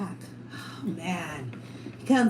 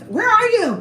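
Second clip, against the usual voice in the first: higher, louder urgent call; 11 semitones higher, 8 dB louder, slower than usual. Retold or imitated. To his coworker while fleeing the naked man, urgent loud call. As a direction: call out to find her fast.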